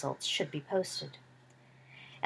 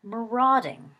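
thoughtful repeat; 5 semitones higher, 6 dB louder, slower than usual. A narrator's reading. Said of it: "Sirius repeated thoughtfully".

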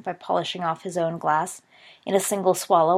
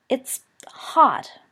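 a whiny little complaint, higher and louder; complaining; 6 semitones higher, 5 dB louder than usual. A narrator's reading. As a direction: grumble it like a boy who burned his tongue. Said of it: "Peter complained".

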